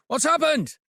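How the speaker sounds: high call over the wind; yelling over wind. Acted. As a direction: shout it above the wind. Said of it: "he yells above the wind".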